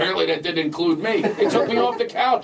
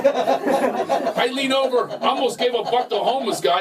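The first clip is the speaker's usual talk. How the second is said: usual speaking voice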